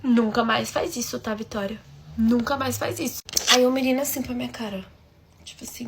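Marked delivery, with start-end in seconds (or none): none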